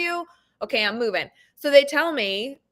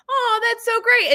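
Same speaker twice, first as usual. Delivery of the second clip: chirpy mom voice; 12 semitones higher, 5 dB louder than usual. Retold or imitated. Parent voiced in story.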